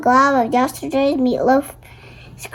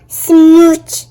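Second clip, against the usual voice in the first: loud smooch; 9 dB louder than usual. Acted. Kiss sound said loudly.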